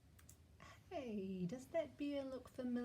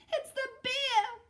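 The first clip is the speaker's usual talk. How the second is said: very high loud cry; frightened cry; 14 semitones higher, 10 dB louder than usual. Acted. Eddie to Mum about the bear, very high frightened cry. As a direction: cry out in terror. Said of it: "cried Eddie".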